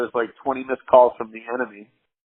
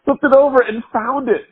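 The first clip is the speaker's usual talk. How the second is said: much higher, laughing, tumbling-out explanation; giddy excited retelling; 11 semitones higher than usual. Retold or imitated. Explaining to the girl where the eight ball came from, giddy and much higher. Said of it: "I'm like".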